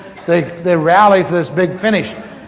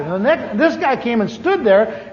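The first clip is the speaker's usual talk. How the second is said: normal talking voice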